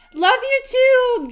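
high, loud, drawn-out shout-out; calling out affectionately. Real, not acted. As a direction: call it out slowly to a fan. Calls back to a viewer's message.

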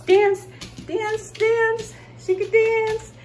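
high, loud cheering; excited dog-talk. Real, not acted. Owner cheering her dog to dance for a treat.

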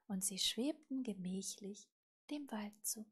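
ordinary delivery

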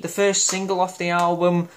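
ordinary talk, nothing marked

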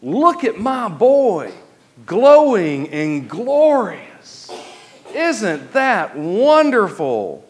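high, slow, doting proud-parent voice; a hammed-up fawning admirer; mock gushing. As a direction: gush over him like a besotted dad. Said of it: "God does not say".

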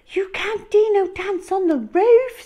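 high, loud worried protest; protesting boy. Acted. Hal calling up to the roof, high and worried. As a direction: tell him off in alarm. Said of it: "said Hal".

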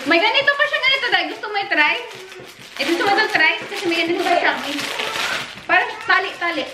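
high, sing-song coaxing; sweet coaxing voice. Real, not acted. Coaxing someone to try clothes.

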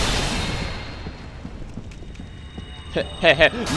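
breathy unvoiced snicker. Real, not acted. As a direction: snicker under your breath. Snickers as boss attacks.

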